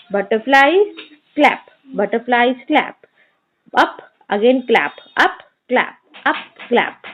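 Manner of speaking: slow chant, one beat per word. Shown as a demonstration. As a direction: say it slowly, clapping each word. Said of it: "Say aloud each line ... clapping for every word".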